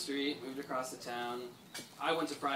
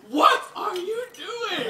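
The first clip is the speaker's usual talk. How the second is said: a high, loud, slow outraged demand; mock angry yell; 8 dB louder, slower than usual. Scolding his friend for missing a block, a high mock yell. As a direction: play the annoyed running back chewing out his blocker. Jules scolding him at football.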